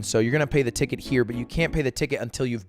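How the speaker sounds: low, fast official recital; clerk's rapid rattle. Retold or imitated. DMV clerk explains the ticket process, fast low rattle.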